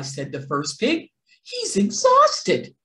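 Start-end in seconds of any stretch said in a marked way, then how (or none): 1.4-2.8 very high, slow sympathetic squeak; high pig voice. A narrator's reading. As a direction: coo it like a worried little pig. Pig pities the wolf.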